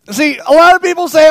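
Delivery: full-throated preaching; preaching at full force. Acted. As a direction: hammer it to the congregation. Preacher at peak intensity.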